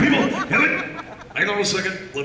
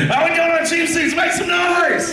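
crowd-hyping shout; 11 semitones higher than usual. Real, not acted. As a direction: whip up the cheap seats. Host hypes the cheap seats.

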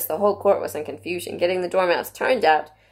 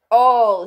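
"All" hit loud and raised; great emphasis; 4 semitones higher, 8 dB louder than usual. A narrator's reading. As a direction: lean hard on "All". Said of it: "he repeated with great emphasis".

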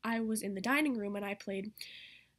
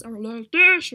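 much louder blaring character voice; a booming odd voice; weird loud voice; 18 dB louder, slower than usual. Shown as a demonstration. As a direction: blast it out as a strange figure. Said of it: "really weird voices like this".

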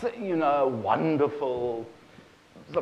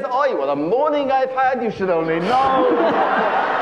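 kvetching wife; 7 semitones higher, 5 dB louder than usual. Acted. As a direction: wail it like a hammed-up kvetch. Wife kvetches instead of moaning.

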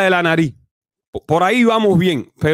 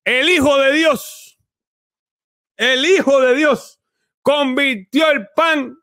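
much higher, slow and grand, swelling to a shout; mock preaching; 9 semitones higher, much slower than usual. Preaching mockingly about the miracle, high, slow and grand. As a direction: proclaim it like a pulpit preacher.

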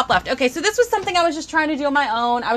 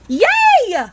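high, drawn-out happy cheer; excited cheer; 9 semitones higher, 4 dB louder than usual. Real, not acted. Streamer still celebrating her dog food mini, excited cheer.

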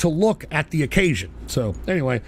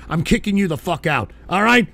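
normal talking voice

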